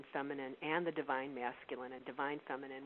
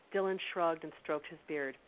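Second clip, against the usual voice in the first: ordinary talk, nothing marked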